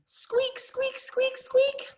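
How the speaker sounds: very high, tiny squeaks; short high-pitched squeaky voice; mouse squeak. Shown as a demonstration. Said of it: "Can you make a squeaking sound?".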